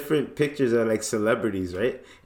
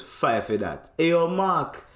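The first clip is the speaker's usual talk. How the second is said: mock Jamaican barista, calling out to Mark higher; light patois call across the shop; Jamaican accent; 6 semitones higher than usual. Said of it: "He goes".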